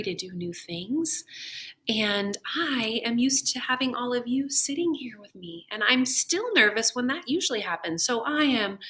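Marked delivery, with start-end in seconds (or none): none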